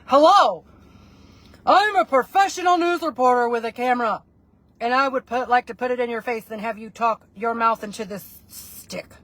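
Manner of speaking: loud, high, mock-formal reporter voice; professional news reporter. Approaching people to interview them, stiff reporter voice. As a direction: put on an overblown reporter's projection. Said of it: "Can't walk up to them and be like".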